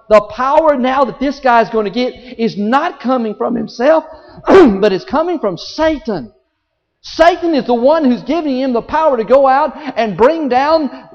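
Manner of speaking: rising, loud pulpit preaching; fiery revival sermon delivery; full-force preaching. Acted. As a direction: preach it hard, building to the crowd.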